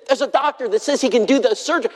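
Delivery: high, eager retold voice; excited good news. Wife tells preacher of news from Vanderbilt, excited.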